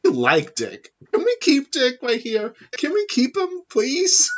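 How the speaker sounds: put-on high, whiny kid voice begging; childish pleading. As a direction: beg like a child for a puppy. Begging to keep Dick like a pet.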